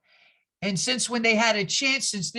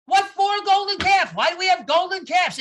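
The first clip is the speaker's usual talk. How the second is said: much higher, louder incredulous shout; baffled Sorkin; 7 semitones higher, 6 dB louder than usual. Retold or imitated. Sorkin shouting at Maureen in disbelief. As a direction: yell back in total disbelief. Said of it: "Sorkin is going".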